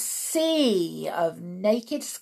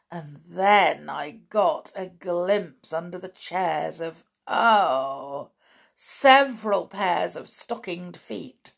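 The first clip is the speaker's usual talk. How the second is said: ordinary delivery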